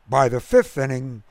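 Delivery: ordinary delivery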